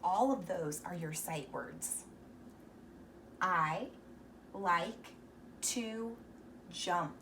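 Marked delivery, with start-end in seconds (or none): 3.4-7.2 each word apart, a pause between; slow reading aloud. Shown as a demonstration. Teacher to kids, slow word-by-word reading. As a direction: read I like to jump one word at a time. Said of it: "see if you read it correctly".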